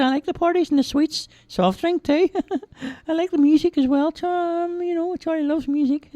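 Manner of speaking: put-on high, childlike simple lad voice; cheerful high-pitched lad; Charlie's voice. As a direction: play the big innocent lug, high and eager. Storyteller voicing Charlie.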